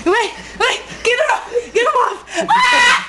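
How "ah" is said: high laughing shriek; laughing scream. Real, not acted.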